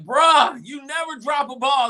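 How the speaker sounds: high, loud mock outcry; exasperated disbelief. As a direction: cry out like a fan who can't believe it. Acting out disbelief at dropped ball.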